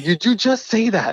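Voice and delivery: high, loud raspy imitation; Super Dave. Guest challenging what was just said, raspy disbelief. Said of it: "he does that raspy voice".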